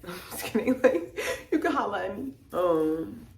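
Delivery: high-pitched laughing through the words. Real, not acted. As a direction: laugh it off as a joke. Laughs at own joke.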